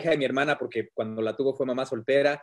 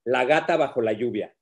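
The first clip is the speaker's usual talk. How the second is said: reading aloud; 4 semitones lower than usual. Acted. Reads his book aloud.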